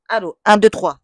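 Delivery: essai micro. Shown as a demonstration. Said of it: "je vais vous le remontrer".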